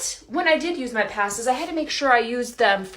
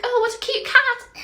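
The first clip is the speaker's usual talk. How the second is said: high sugary cooing at a cat; Umbridge cooing; 3 dB louder than usual. Retold or imitated. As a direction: coo it like a sweet fake lady. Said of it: "Umbridge would be like".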